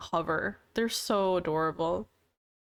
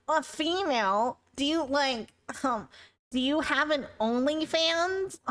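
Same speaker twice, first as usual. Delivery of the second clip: creepy chatter; 4 semitones higher than usual. Retold or imitated.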